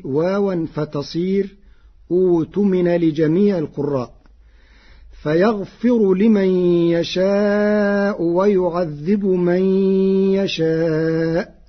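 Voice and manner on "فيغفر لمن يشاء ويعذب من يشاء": slow chanted Quran verse; ترتيل بطيء; Hafs recitation. Shown as a demonstration. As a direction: stretch يشاء in tarteel. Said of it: "قرأ ورش".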